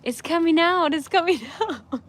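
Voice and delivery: high, urgent retold plea breaking into a laugh; mock panic. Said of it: "he started panicking".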